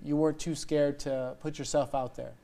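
ordinary delivery

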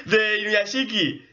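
high, squealed title; overwhelmed excitement. Real, not acted. Fan naming the anime after a discovery, overwhelmed. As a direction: squeal out the name, still overcome.

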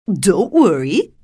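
loud, drawn-out, swooping character voice; Tin Woodman. A narrator's reading. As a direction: reassure as the Tin Woodman, slowly. Tin Woodman reassures them.

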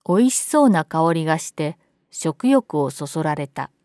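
usual speaking voice

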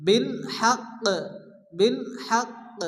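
হাক্ক stopped on q with a small echoing release; light bounced stop. Shown as a demonstration. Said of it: "হালকা একটু ধাক্কা দিয়ে".

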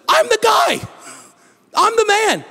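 very high, emphatic claim; eager beggar. Retold or imitated. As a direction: insist with wide-eyed excitement.

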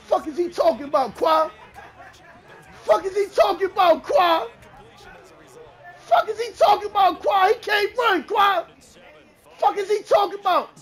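high, loud indignant hollering; heated, repeated shouting; shouted trash talk. Real, not acted. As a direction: yell it in disbelief at your rival. Heated trash talk during game.

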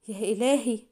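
mild alarmed exclamation; a hint of dismay, falling away; alarmed cry. A narrator's reading. Mona hearing the cure may kill him, mildly alarmed. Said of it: "هتفت منى في جزع".

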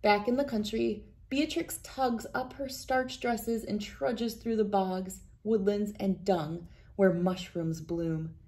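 usual speaking voice

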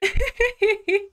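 high burst of laughter; a sudden bright giggle; laughing. Real, not acted. Laughs at sarcasm remark.

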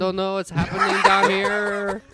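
put-on high, loud comic Spanish accent. Drunk man phoning his girlfriend, helpless mock Spanish accent. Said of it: "talking in a Spanish accent".

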